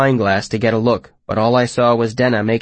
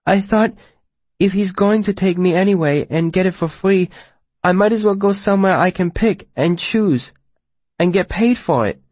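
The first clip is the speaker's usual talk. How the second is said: put-on higher young girl's voice, pleading; 8 semitones higher than usual. A narrator's reading. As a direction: speak it as a desperate young girl. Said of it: "the girl spoke loudly enough".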